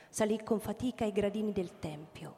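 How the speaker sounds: normal talking voice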